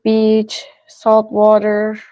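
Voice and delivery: ordinary delivery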